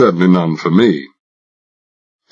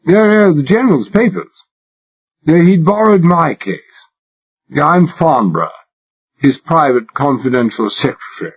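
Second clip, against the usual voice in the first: put-on high-class English accent laid on thick, higher; exaggerated British accent; 9 semitones higher than usual. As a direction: play the posh Englishman to the hilt. Narrator voices himself as posh Englishman.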